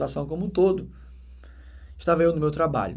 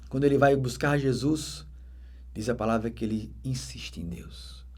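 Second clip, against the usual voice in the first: ordinary talk, nothing marked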